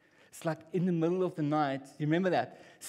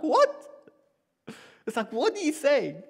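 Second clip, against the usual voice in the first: much higher and louder, incredulous; shocked disbelief; 8 semitones higher, 6 dB louder than usual. Retold or imitated. Preacher voices disbelief at God's command to sacrifice a son, shocked. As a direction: react as if you can't believe your ears.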